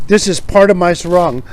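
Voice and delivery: usual speaking voice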